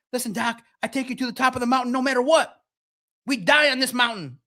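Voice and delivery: the fifty-grand Sherpa rallying Doc; gung-ho elite guide. Retold or imitated. Elite Sherpa voiced, heroic.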